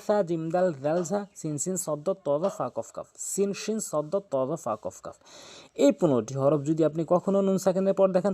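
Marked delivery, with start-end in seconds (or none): none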